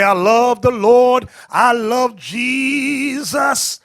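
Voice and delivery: slow drawn-out mock piety; sing-song imitation of devout sisters; mock pious sister. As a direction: parody a churchgoer, stretching each word. Mocking sisters' church talk.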